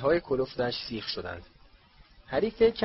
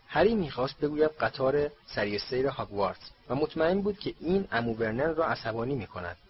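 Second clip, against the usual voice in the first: ordinary delivery, nothing marked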